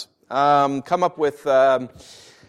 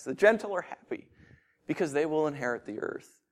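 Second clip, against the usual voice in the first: "The gentle are happy" said much softer, lingering and tender; soft, savoring; 4 semitones lower, 10 dB quieter than usual. Shown as a demonstration. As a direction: murmur "inherit the earth" gently, as if tasting it. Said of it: "This sounds so good".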